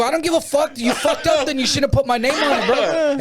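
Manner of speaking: high-pitched, laughing fake-tough comeback; mock tough yell. Telling off a pushy DVD hustler, mock tough yell. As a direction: squeak out a defiant brush-off, half laughing. Retold standoff with street hustler.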